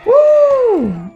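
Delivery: high loud whoop; relieved whoop. Real, not acted. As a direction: let out relief. Relief after surviving.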